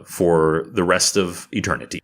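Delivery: ordinary delivery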